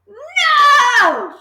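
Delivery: high, loud anguished no; horrified cry. A narrator's reading. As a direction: cry out in dread. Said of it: "she cried".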